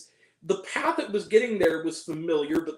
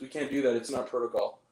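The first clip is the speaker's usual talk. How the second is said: low, soft imitation of a wooden actor; emotionless; 7 semitones lower, 4 dB quieter than usual. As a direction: recite it low with no feeling at all. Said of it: "Not with any emotion, just".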